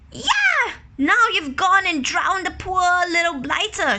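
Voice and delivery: high, loud dismayed shout; shouting young man. Acted. Young man shouting at the small boy, high and loud. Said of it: "shouted the young man".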